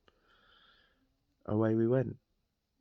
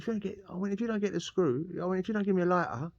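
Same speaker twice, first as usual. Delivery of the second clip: much higher, pushing demands; drunk threats; 9 semitones higher than usual. Retold or imitated. To the night guard, demanding a lighter in drunken threats. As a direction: stammer out threats through the door.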